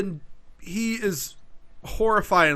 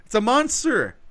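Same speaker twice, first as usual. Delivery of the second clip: mock horror; 9 semitones higher, 4 dB louder than usual. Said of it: "are kinda like".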